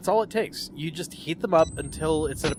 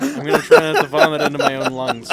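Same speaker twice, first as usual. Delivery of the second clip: louder words pushed out through a burp; 9 dB louder than usual. Real, not acted. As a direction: laugh and burp through the line. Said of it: "Excuse me while I burp myself to death".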